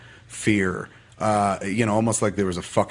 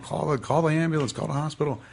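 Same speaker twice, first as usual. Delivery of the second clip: higher, hurried-sounding mock panic; mom freaking out; 7 semitones higher than usual. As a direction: imitate a mother urgently calling for help. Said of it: "Mom freaked out".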